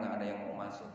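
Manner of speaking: ordinary talk, nothing marked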